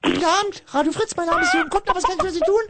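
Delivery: high, fast, chirpy service greeting; customer service voice. Shown as a demonstration. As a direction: greet callers like a hotline. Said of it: "So eine Sendung ist das hier aber nicht".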